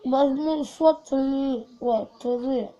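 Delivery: commands mumbled through a stuffed mouth; mouth full. Real, not acted. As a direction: mouth crammed full, words garbled. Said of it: "Сложно говорить".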